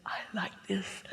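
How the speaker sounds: soft, unvoiced-sounding whispered delight; hushed whisper. Speaker voicing inner delight on a mountain trip, whispering. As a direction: whisper it, savouring the moment. Murmured repetition building toward ecstasy.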